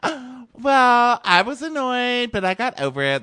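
high, loud, flustered mock voice; mocking whiny girl. Mocking the girl confronting Wells, high and flustered. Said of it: "And she goes".

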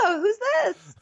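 high-pitched hammed-up drop-in greeting; squeaky phony surprise; mock casual host. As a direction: play a host with an unexpected dinner guest, way up high. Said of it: "acts like somebody dropped by".

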